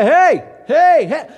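loud high "hey, hey" to a truck; shouting to a passing truck; calling out. Acted. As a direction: flag down the passing semi. Waving down a passing semi.

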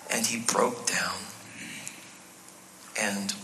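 soft, low, slow near-whisper; hushed. A narrator's reading. Preacher reads Peter's weeping to congregation, hushed. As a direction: let it sink away quietly. Solemn end of Peter's denial story.

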